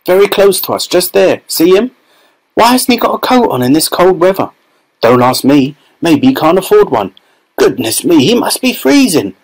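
high, animated crowd gossip; excited onlooker. A narrator's reading. Onlookers chatter excitedly about someone they see.

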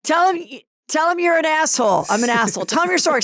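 high, pushy egging on. Retold or imitated. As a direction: egg him on from off camera. Off-camera guys egging him on.

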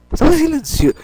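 sudden high startled yelp. Real, not acted. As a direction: jump at the sound and cry out. Startled by sudden noise in game.

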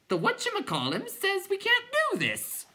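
very high squawky clown; clown creature voice. A narrator's reading. Clown informing the other clowns, very high voice. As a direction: pipe it out in a silly high voice.

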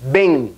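bien said as beŋ with a nasal e, m almost lost; nasal bem. Shown as a demonstration. (e nasal en bien)

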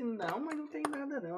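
high-pitched quick denial; nervous denial. Retold or imitated. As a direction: squeak it out like a caught son. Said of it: "Aí eu falei assim".

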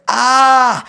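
loud, high cry of "ah"; retold scream. Lost in the medicine vision, screaming. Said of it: "I screamed".

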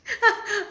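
high, delighted squeal of a laugh; laughing off the profe sexy compliment. Real, not acted. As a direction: burst into a high giggle.

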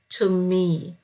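ordinary talk

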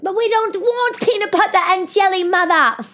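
put-on high, complaining child voice; whiny kids. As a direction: whine like fussy kids. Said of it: "they're like".

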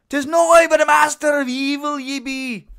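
put-on thick Scottish accent, shouted high; booming hammy Scottish accent. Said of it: "very thick Scottish accent".

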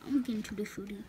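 hushed hopeful whisper. Real, not acted. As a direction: whisper it to yourself. Hopes, whispering to herself.

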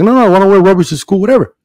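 imitating a child's insistence; protesting little boy. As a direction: mimic a small son refusing, fast and high. Acts out his protesting young son.

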